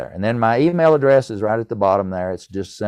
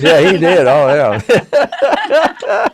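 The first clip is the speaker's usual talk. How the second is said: laughing; 8 semitones higher, 7 dB louder, much slower than usual. Real, not acted. Laughing at the salesman story.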